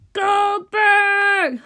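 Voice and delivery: long, high, loud holler; bellowed name. A narrator's reading. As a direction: yell the name to wake the whole house. Sudden yell wakes the town.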